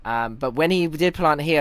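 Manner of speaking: ordinary talk, nothing marked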